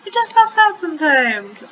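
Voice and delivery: high, evasive character voice; covering up. A narrator's reading. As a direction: brush it off in a high-pitched voice. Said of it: "I covered".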